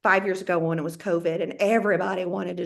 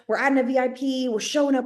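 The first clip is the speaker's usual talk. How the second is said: ordinary talk, nothing marked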